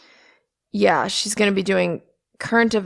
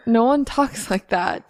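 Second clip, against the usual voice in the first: ordinary delivery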